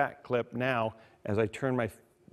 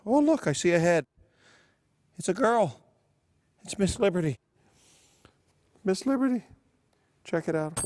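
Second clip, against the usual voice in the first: excited; 8 semitones higher, 5 dB louder than usual. Pretend. Mock birth, coin finally appears.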